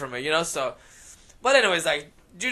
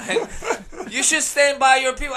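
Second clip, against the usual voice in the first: laughing; 7 semitones higher, 6 dB louder, slower than usual. Real, not acted. Laughing while telling off.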